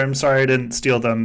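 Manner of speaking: ordinary delivery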